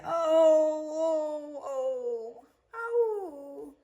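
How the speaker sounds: high, wavering wail of protest; a Cockney girl's hammed-up wail. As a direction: let the ohs climb into a wail. Liza wails at being binned.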